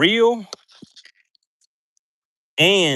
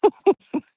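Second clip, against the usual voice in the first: high laugh; 4 dB louder than usual. Real, not acted.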